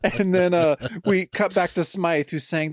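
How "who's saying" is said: ordinary talk, nothing marked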